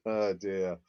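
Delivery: normal talking voice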